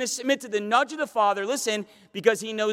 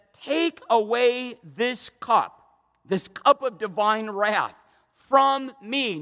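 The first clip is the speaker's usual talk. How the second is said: slow pleading prayer; much slower than usual. Acted. Voicing Jesus' prayer, drawn out.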